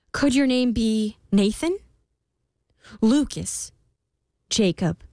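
rising, hopeful queen guesses; hopeful guessing. A narrator's reading. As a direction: try each name hopefully.

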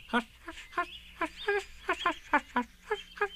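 high tiny whispered hushes; very quiet hushing. A narrator's reading. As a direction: hush to himself, barely audible. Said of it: "very quietly".

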